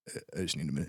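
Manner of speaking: low, soft, stunned murmur; dazed low voice. Retold or imitated. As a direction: say it quietly, shell-shocked. Imagined dazed person answering.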